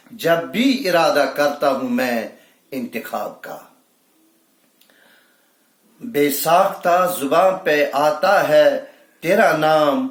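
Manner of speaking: emphatic mushaira declamation; ringing Urdu couplet delivery; poetry recitation. Acted. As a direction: build each repeat of the verse.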